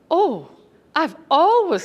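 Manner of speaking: excited. Retold or imitated. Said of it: "I get really excited then".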